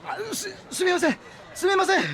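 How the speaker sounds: flustered apology. Acted. Allen apologizes to the man he bumped, flustered and high.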